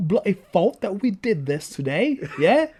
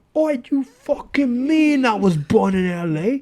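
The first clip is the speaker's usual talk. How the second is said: hammed-up Cockney, higher and louder; mock Cockney; 5 semitones higher, 5 dB louder than usual. Co-host defending his mock Cockney roots with loud bluster. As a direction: bluster in a put-on Cockney accent. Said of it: "I'm Cockney".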